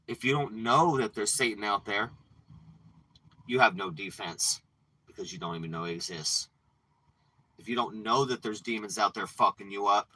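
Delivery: ordinary talk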